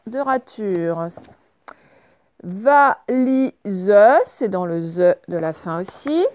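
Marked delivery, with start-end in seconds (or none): 2.4-3.9 drawn-out, separate syllables. Shown as a demonstration. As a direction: sound it out for pupils. Correction, découpe le mot en syllabes.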